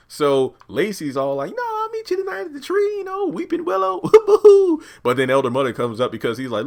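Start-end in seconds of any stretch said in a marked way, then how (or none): 1.6-4.0 very high, put-on falsetto voice; mock flirty Lacey. Lacey setting up a tryst with Oliver, mock flirty. 4.1-5.0 high, loud put-on giggle; mock giggle. Lacey giggling about the tryst, high and mock flirty.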